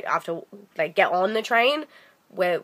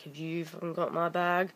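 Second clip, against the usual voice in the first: much softer muttered accusation; under her breath; 5 semitones lower, 13 dB quieter than usual. Retold or imitated. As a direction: mutter it to yourself. Silent accusation toward passengers.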